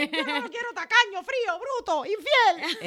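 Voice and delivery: squeaky high quick listing of complaints; mimicking complaining women, high and fast; high mock whine. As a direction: rattle off complaints in a high mocking voice.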